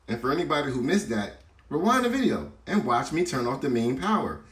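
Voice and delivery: high, emphatic, sarcastic telling-off; exasperated scolding. Real, not acted. Host answering viewers who complained in comments, exasperated scolding. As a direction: lecture the doubters, pitch pushed up.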